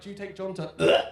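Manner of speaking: loud slow wedding-officiant voice; lightly voiced vicar at the altar; mock officiant. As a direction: intone it slowly like a vicar. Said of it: "they're like".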